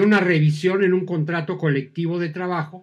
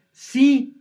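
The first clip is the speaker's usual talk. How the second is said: a bright, loud, high yes; eager yes; 8 semitones higher, 8 dB louder than usual. Shown as a demonstration. Girlfriend answering a proposal, delighted and loud. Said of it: "Y ella les contesta".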